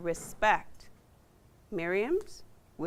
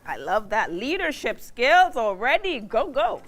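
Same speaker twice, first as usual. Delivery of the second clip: loud, rising cheer of approval; enthusiastic praise; 3 semitones higher, 9 dB louder than usual. Real, not acted. Teacher cheering on the first student to volunteer, enthusiastic.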